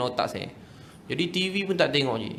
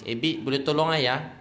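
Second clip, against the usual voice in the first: ordinary delivery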